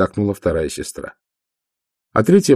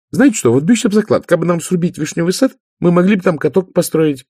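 high, loud, excited pitch of an idea; youngest sister, eager; 11 semitones higher, 5 dB louder than usual. Acted. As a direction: propose it as the keen little sister.

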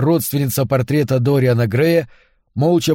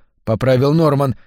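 ordinary delivery, nothing marked

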